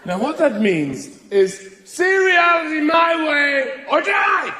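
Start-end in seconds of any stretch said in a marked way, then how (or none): 1.8-4.5 slow, loud, higher mock threat; a hammed-up ultimatum, drawn out; mock furious shout. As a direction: bellow it like a tyrant. Comic translation of the phrase.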